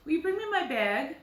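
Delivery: northern Minnesota accent, lifted pitch. Shown as a demonstration. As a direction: imitate a Minnesotan, raising the vowel in "bag". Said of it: "they would say bag".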